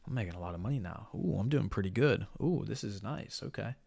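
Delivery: soft, smug, self-satisfied musing; complacent. Retold or imitated. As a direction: imitate someone getting comfortable with money. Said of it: "get the sense of".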